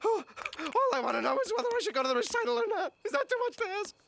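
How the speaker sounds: high, strained pleading question; exasperated whine. Acted. Man asks his other selves about the recital, exasperated whine. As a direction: whine it high, at wits' end.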